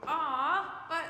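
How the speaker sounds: loud, high mock whine; whiny voice. Shown as a demonstration. Pastor practises the reaction to disliked things, playful whine. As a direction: put on a child's protesting whine. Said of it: "Something you don't like, we'll go".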